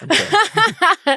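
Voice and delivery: high laughing okay; squeaky laughing okay. Real, not acted. Laughing disbelief.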